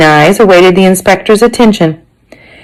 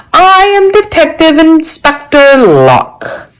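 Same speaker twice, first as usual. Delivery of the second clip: slow, high, pompous announcement; announcing inspector; 10 semitones higher, slower than usual. A narrator's reading. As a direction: announce yourself grandly as a police inspector. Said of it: "the man announced".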